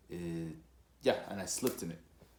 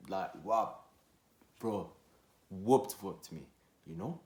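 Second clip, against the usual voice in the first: normal talking voice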